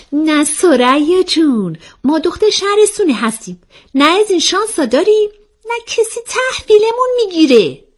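high, lilting protest; self-deprecating girl character. Acted. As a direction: brush off the teasing in a high voice. Acts out the character's reply.

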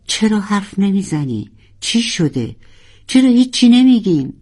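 rising to a high loud plea; distressed. Acted. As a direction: panic building into a cry. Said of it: "ناروال پریشان گفت". (پریشان و رو به فریاد)

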